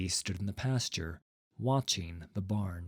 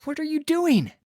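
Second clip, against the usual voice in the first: shocked cry; 8 dB louder than usual. Acted. Boy sees the farmer struck.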